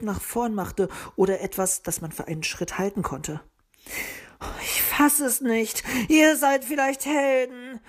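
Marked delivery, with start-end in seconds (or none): 4.6-7.8 slow, high sarcastic sigh; exasperated groan. A narrator's reading. Luzi despairs at the two timid gnomes. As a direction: groan at their cowardice. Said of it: "stöhnte Luzi".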